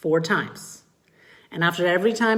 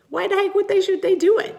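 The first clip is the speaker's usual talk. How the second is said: high, loud disbelief; why the heck, rising sharply; mock outrage at chore logic; incredulous; 5 dB louder, faster than usual. Real, not acted. Indignant rhetorical question to parents.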